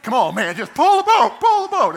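high, frantic yelling; acted panicked shouting; adrenaline-fuelled shout. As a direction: yell at your crewmates to row for their lives. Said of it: "that adrenaline's back. And now they're like".

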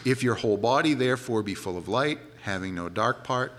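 a preacher quoting Jesus, solemn scripture reading. Acted. Quotes King James scripture.

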